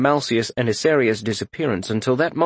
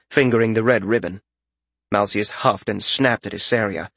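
ordinary delivery, nothing marked

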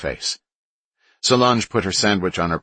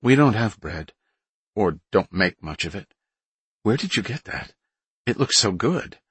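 higher, eager envious woman; envious nurse; 4 semitones higher than usual. Acted. Karen craving the bread.

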